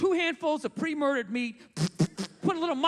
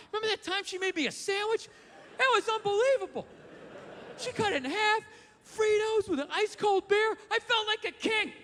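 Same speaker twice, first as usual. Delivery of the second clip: higher, fond, wistful self-talk; dreamy reminiscing self; 8 semitones higher than usual. Retold or imitated. Comic recalling his wife's sandwich to himself in the car, high and wistful. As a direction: gush over the memory like a happy child.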